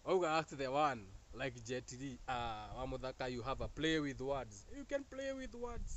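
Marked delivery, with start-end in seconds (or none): 4.8-6.0 playful tease. Real, not acted. Playful teasing.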